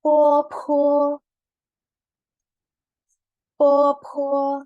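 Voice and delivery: Po with a strong puff of air, loud; Po, po: breathy aspirated p. Shown as a demonstration. Teacher showing the airy p. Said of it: "P ada anginnya".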